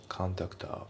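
コンタクター as English "kan-tak-ta"; linking. Shown as a demonstration. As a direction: run the words into one コンタクター. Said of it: "リンキングで".

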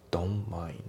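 "Don't mind" with no T, like "don mind"; dropped T. Shown as a demonstration. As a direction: glide from N straight to M in "Don't mind". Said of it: "Tが抜けますね".

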